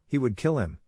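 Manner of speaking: plain reading voice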